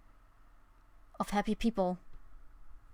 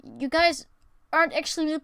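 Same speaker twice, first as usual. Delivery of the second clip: much higher, louder mock tantrum; angry yell; 9 semitones higher, 8 dB louder than usual. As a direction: throw a hammed-up tantrum at chat. Mock outburst at chat.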